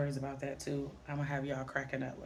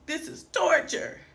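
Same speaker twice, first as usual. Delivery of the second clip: dramatic despair; 11 semitones higher, 8 dB louder than usual. Retold or imitated. Child ready to cry on skates.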